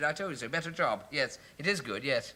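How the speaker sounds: ordinary talk, nothing marked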